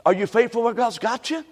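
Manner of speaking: normal talking voice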